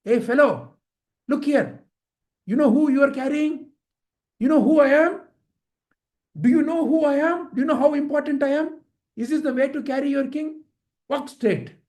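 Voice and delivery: indignant, high-pitched scolding; reprimanding king. Acted. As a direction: pull rank on a lowly servant. King reprimands the new bearer.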